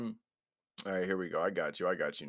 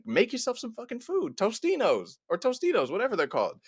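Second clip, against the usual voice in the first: annoyed parent; 11 semitones higher, 4 dB louder than usual. Retold or imitated. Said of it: "I'd be like".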